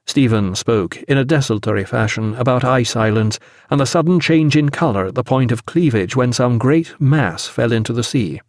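usual speaking voice

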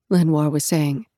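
ordinary delivery, nothing marked

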